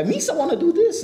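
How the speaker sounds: comical high-pitched goofball; Jar Jar. Retold or imitated. Interviewer imitating the comic relief character, high and silly. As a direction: squeak it out high, playing the clown. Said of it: "like that comical guy".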